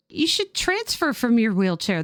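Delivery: ordinary talk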